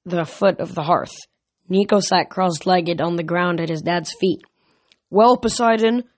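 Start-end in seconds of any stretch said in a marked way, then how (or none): 5.1-6.0 louder, higher, pointed address; mildly disgruntled challenge; grumbling. Acted. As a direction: challenge him with mild grumpiness. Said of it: "Zeus grumbled".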